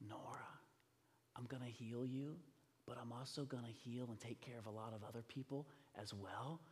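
tender reassurance. Retold or imitated. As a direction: speak softly as Jesus comforting a child. Voices Jesus comforting the girl.

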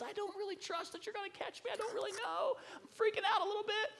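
high-pitched mock panic; panicky daughter. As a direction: squeal it like a nervous kid freaking out. Daughter voiced panicking before trust fall.

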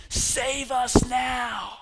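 high, slow, loud cry for rescue; desperate shout. Acted. Crowd calling on Jesus to save them, a high slow shout. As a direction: call it out slowly like a pleading crowd.

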